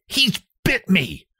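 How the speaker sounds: high, slow, aggrieved wail; pained cry. A narrator's reading. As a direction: cry out that it hurts. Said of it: "she cried".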